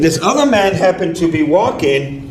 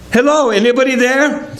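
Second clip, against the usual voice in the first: calling down; 8 semitones higher than usual. Acted. Passerby calls down into hole.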